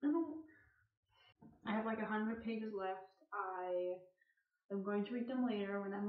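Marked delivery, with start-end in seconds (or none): none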